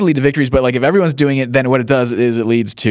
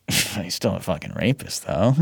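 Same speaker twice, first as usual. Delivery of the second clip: quiet smug aside; 6 semitones lower, 9 dB quieter than usual. Retold or imitated. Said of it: "and I'm like".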